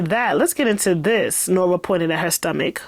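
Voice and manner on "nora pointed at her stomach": usual speaking voice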